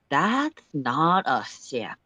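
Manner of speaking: slow, drawn-out doubt; slowly. Acted. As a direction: let each word hang as he studies the image. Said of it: "Sullix says slowly".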